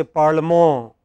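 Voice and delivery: "parlement" said French-style as par-luh-MOH, final t silent; French pronunciation. Shown as a demonstration. Teacher contrasting French with English, French final stress on "parlement". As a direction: stress the open last syllable of "parlement". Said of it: "It's always the last syllable".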